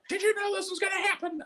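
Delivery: high, fast mock cop shout; mad cop. Comic imitating the furious cop questioning him. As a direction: squeal it like an outraged cop. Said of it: "He was like".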